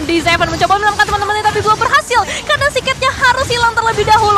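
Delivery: fast, loud, high play-by-play; esports caster. Real, not acted. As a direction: rattle off the fight quickly. Fast teamfight commentary.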